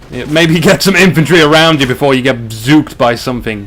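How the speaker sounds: usual speaking voice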